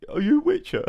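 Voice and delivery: very high, put-on simpleton voice; peasant villager voice. Villager asks the stranger who he is, high peasant voice. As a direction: ask it as a timid, comic peasant. Depressed villager addresses the Witcher.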